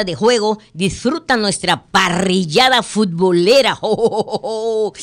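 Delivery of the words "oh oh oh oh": laughing through the oh's. Real, not acted. Host laughing mid-ad. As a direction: break into a real laugh.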